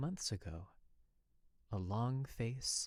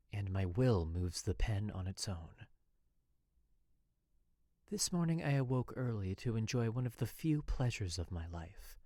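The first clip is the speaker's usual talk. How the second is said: usual speaking voice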